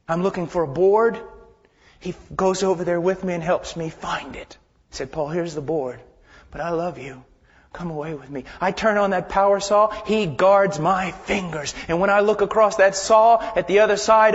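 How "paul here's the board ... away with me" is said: low, soft, kindly voice; quiet, affectionate and unhurried; tender divine invitation. Acted. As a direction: offer help gently, then coax softly. God lovingly helping, voiced gently.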